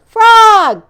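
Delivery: high, loud call; boastful call to his friend; loud high cry; cried out. Acted. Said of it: "cried Toad".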